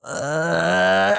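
long creaky groan rising into a screech; imitated screech. Storyteller mimicking the hobo starting to move, screeching. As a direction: imitate a drawn-out, strangled screech. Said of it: "it just the fucking screech".

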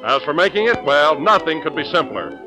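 bright announcer ad read; chipper 1950s ad. Acted. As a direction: sell the dessert with cheer. Jell-O commercial read.

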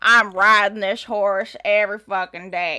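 mock Southern twang; Southern accent. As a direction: play a Texan cowgirl. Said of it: "I can do a pretty good one".

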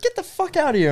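very high mock squeal; mom freaking out. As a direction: squeal it like the mom, without shouting. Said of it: "she started freaking out".

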